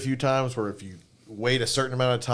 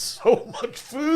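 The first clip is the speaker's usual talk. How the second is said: exaggerated disbelief; 5 dB louder, much slower than usual. Real, not acted. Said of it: "exaggerating meal size".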